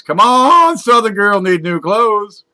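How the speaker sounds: very high, loud cheer, the last words slow; yelling. Acted. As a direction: shout at the dice to win. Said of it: "and yells".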